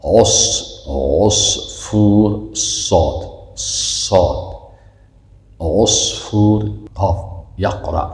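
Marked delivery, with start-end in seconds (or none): 0.0-6.7 "عصفور" drawn out slowly with a dark, full s; full, heavy sad. Shown as a demonstration. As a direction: break "عصفور" open and thicken the "صاد". («عصفور» آهسته با صاد پر)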